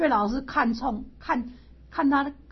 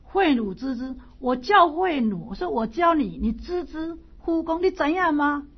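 louder, higher, pressing the student; emphatic Confucius; 5 semitones higher, 4 dB louder than usual. Acted. Confucius pressing Zilu, loud and emphatic. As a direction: drill the question home like a stern teacher.

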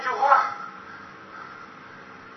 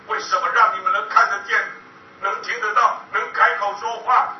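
loud, bitter shouted accusations, climbing higher; furious outburst; 7 semitones higher, 8 dB louder than usual. Retold or imitated. Survivor raging at people who still have families, shouting. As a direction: hurl each why at them in rage.

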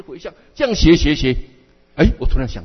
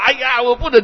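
whiny tantrum; 8 semitones higher than usual. Acted. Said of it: "我很会耍赖哦".

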